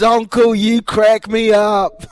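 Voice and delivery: loud, high, slow mock fan voice; imitating the fan laughing. Retelling the cafe fan calling him uncle, loud mock voice. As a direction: ham up the amused stranger. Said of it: "He, he, he goes".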